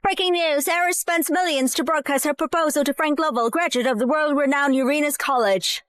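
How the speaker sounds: anchor read over a TV speaker; news broadcast. Acted.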